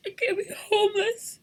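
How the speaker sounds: pleading, voice climbing; desperate plea. Real, not acted. Mother fearing homelessness, desperate plea.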